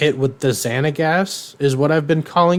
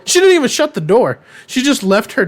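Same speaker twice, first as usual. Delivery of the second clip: indignant outburst; 10 semitones higher, 5 dB louder than usual. Real, not acted. Indignant retelling.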